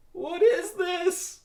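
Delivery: very high, slow frightened cry; drawn-out dread; terrified slowed wail; slow-motion fear. Acted. Barry terrified, ball approaching.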